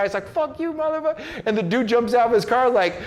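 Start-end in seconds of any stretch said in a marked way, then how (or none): 0.3-1.2 sharply higher-pitched retold curse; mock cursing. Skinny kid cursing out the driver, pitched-up mock rage. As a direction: spit out the kid's insult in a much higher voice. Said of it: "cursing the dude out".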